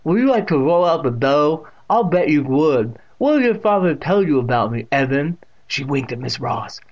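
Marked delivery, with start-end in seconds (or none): 0.0-5.4 loud deaf great-aunt's voice; Catherine. A narrator's reading. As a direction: speak out distinctly as the deaf aunt. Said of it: "with that distinct pronunciation".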